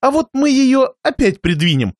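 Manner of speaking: высокий, деланно бодрый голос; подхвати игру гостя через силу; печальный хозяин. A narrator's reading. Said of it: "печальный хозяин и прибавил". (натужно любезный хозяин)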